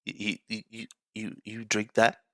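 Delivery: hushed, stammering disbelief; shocked stammer. Retold or imitated. Water boy staring at the dirty water, hushed shocked stammer. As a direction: stutter out the question in quiet shock. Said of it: "the utter shock on Bobby's face".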